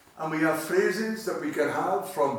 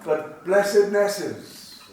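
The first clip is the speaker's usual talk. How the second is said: blessednesses drawn out slowly and loud; slow emphatic word; 4 semitones higher, 6 dB louder, much slower than usual. Shown as a demonstration. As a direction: lean on blessednesses, spelling out each syllable. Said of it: "it's blessednesses".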